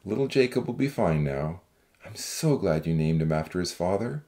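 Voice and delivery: deep, reassuring man's voice; low and steady kindness; kindly, steady. Acted. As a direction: reassure a mother, voice dropped very low. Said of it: "looking at her kindly and steadily".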